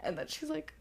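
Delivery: ordinary talk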